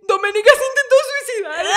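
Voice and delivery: high, loud fake alarm; mock panic. Lying to Alex before the officer, high fake panic. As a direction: sell the lie in a panic. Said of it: "le dije".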